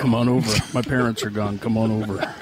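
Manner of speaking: close-mic creepy come-on. Acted. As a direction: lure them in, creepy and close. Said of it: "a lot of creepiness".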